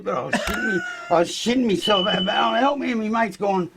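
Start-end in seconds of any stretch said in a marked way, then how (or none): 2.4-2.9 higher, half-joking cry for help; mock panicked plea. Teen stuck in crashed car calling to his mate, mock panic. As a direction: play up the kid's panic, pitched high.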